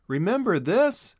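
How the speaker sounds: a hint of a playful boy; young Chisel. A narrator's reading. Chisel voiced.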